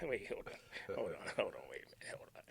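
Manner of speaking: hushed wait hold on; whispered disbelief. Retold or imitated. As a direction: breathe it out in disbelief. Reacting to being refused.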